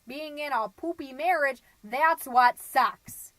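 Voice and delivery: loud, slow, emphatic retold rant; heated outburst. Tipsy divorcing friend ranting at book club, loud heated outburst. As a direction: build to hammered, drawn-out final words. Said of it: "And she said".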